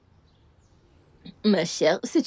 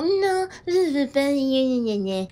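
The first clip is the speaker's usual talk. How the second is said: higher, slow whine ending in gna gna; mock her niece's complaining; mocking whiny child; 5 semitones higher, slower than usual. Aunt mimics the girl's earlier whining, mocking. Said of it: "tu étais genre".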